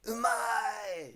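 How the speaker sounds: loud high shout of delight; mock food shout. Streamer miming a TV taster, loud delighted shout. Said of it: "Everything you eat".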